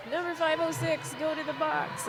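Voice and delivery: high mock referee call; referee's yell. Announcer imitating a referee ordering a penalty. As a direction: call her to the box like a ref. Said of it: "rely on them to yell at me".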